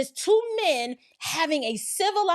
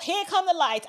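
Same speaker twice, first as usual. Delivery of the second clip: normal talking voice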